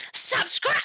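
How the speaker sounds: very high-pitched subscribe shout-out, cut short; failed attempt at a high cartoon voice; put-on character voice. Shown as a demonstration. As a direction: squeal it in a silly high voice. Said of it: "This is my tone voice".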